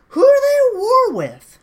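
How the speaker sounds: high, loud shouted question. Real, not acted. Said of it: "ask this question".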